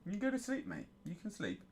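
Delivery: tender sing-song hush to the dog; soothing the dog. Real, not acted. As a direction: ease him off to sleep.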